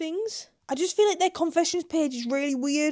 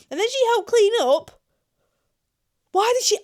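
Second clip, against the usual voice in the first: high, loud outburst of astonishment; incredulous near-shriek; loud disbelief; 10 semitones higher, 7 dB louder than usual. Real, not acted. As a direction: burst out appalled, pitch leaping up. Appalled that partner helped clean.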